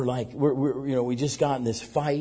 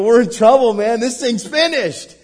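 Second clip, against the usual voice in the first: higher, louder cry, slowing at the end; despairing; 9 semitones higher, 10 dB louder than usual. Retold or imitated. Pastor telling his friend all is lost, loud despairing cry. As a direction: wail the bad news.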